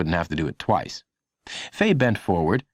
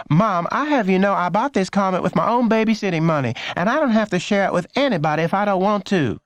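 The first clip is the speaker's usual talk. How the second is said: put-on high, huffy teen girl voice; a big sister defending her comic; high indignant girl; bickering older girl; 11 semitones higher than usual. A narrator's reading.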